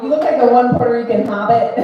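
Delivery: ordinary delivery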